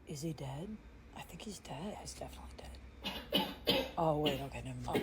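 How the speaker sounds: hushed unvoiced whisper; frightened whisper to friend. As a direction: whisper it over the body. Whispering over a body.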